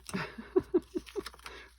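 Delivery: high rising giggle; amused high laugh at her own mistake. Real, not acted. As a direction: let out a high giggle at the slip.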